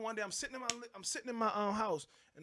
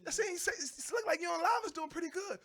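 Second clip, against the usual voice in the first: higher, louder insinuating voice; mock gossiping exes; 9 semitones higher, 8 dB louder than usual. Ex-girlfriends messaging his wife, higher insinuating voice. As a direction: stir up trouble with a sly question.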